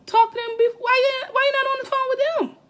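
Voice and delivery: exasperated. Real, not acted. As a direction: throw up your hands in disbelief. Exasperated rant at hesitaters.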